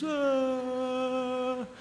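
airy otherworldly ah of a dead man; mock ghostly moan; ghostly sigh. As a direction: breathe out like a drifting spirit. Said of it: "faz quatro dias".

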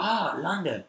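put-on animated Chinese-accented broken English; singsong imitation of Chinese-accented English; imitated Chinese accent. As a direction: announce it in a mock Chinese accent. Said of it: "I'm gonna impersonate their accent".

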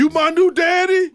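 S1 plays the woman's son, high-pitched; high mock voice of her kid; son's voice. Joking as the son meeting her date, high voice. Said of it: "And then her son".

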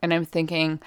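ordinary talk

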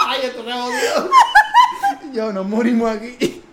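high, loud, laughing mock doom; laughing mock panic. Retelling own thoughts when dad prayed, laughing panic. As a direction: cry out through laughter.

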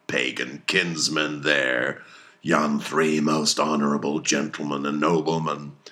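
put-on a fierce old sea captain rallying his crew; commanding, hammy captain with bursts of loud toasts; Captain Ahab voiced. Ahab to his mates and harpooneers, commanding and fierce.

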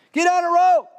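very high, loud rescuer's yell; urgent acted shout; calling down. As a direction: holler down from a bridge. Man on bridge calls down.

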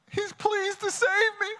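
high, slow tearful voice through laughter; reliving his own weeping as he tells his wife; retold sob. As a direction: choke it out half laughing, half crying. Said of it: "I was bawling".

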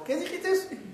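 high, soft threatening "¿Qué dijiste?"; wife with a bat daring him to repeat it; high, quiet threat; menacing wife. Retold or imitated.

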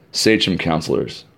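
plain reading voice

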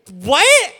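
high, loud, stretched-out protest; hammed-up astonished shout; mock incredulous. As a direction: exclaim it in exaggerated disbelief. Acts Moses' shocked reply to complaints.

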